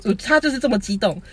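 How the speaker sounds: ordinary talk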